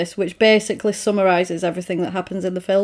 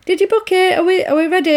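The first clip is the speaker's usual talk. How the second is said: imitating her, pitched much higher and eager; eager Liz; 10 semitones higher, faster than usual. Girlfriend on the phone asking if he booked, high eager mock voice. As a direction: girlfriend checking the booking, eager and bright, pitched way up. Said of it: "Liz rings up and she's like".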